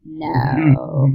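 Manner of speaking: low, creaky drawn-out no; mock vocal fry. Jokingly agreeing about the Broad City girls, vocal fry. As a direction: drag it out in a low croak.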